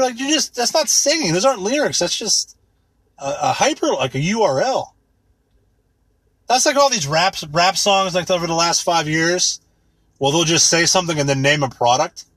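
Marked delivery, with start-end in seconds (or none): none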